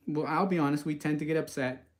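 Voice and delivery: usual speaking voice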